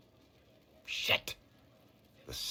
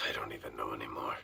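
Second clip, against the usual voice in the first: soft, lower, fast whisper; rueful whisper; 10 semitones lower, 8 dB quieter, faster than usual. Acted. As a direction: breathe it out, half to himself. Doubting himself after being reassured.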